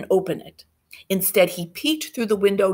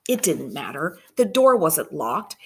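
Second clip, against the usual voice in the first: usual speaking voice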